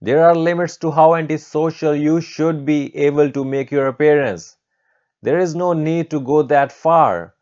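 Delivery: high, loud indignant tirade; screaming scolding. Acted. Teacher rants at student about his antisocial look, screaming scolding. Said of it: "she gave a big scream once again".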